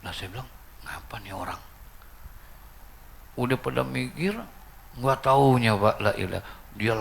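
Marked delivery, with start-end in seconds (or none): 0.8-1.6 soft, understated retold question; puzzled aside. Puzzled aside about the widower.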